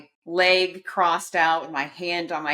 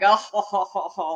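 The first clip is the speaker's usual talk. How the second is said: chuckling crowd; 4 semitones higher, 4 dB louder than usual. Retold or imitated. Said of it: "started chattering like".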